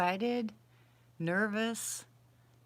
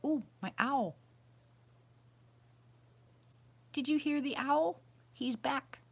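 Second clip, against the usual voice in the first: high, eager whisper-free gush over the owl; thrilled, voice lifted way up; excited; 8 semitones higher than usual. Real, not acted. Hearing her owl return, high excited voice. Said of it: "hear the excitement in my voice".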